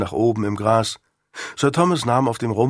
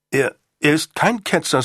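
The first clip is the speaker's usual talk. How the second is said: higher-pitched, indignant denial; startled protest; 5 semitones higher than usual. Acted. Son defends father to Sir Thomas, startled higher-pitched protest.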